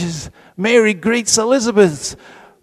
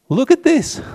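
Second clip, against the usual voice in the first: much higher, eager push on "Look at this"; excited call for attention; 9 semitones higher than usual. Real, not acted. Preacher excitedly urging the congregation to look. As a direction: lift it high to grab the room.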